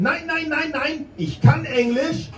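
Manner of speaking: proud German protest; insistent German refusal. Retold or imitated. Girlfriend refusing help in German.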